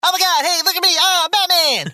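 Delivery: very high, loud comic squeal; hammed-up high-pitched excitement; Aziz Ansari. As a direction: yell for attention in a squeaky voice. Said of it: "You doing Aziz Ansari?".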